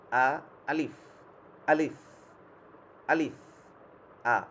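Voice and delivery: আলিফ called out loud and firm for repeating; teacher's loud repeat-after-me আলিফ; alif drill. Shown as a demonstration. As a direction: lead the class: আলিফ, pause, again. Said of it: "আমি যখন বলবো, আপনারা বলবেন আলিফ".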